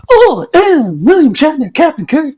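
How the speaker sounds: high, loud over-the-top Shatner parody; exaggerated, strained parody voice; Jim Carrey. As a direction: ham up a ridiculous starship captain. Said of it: "do the Jim Carrey impression".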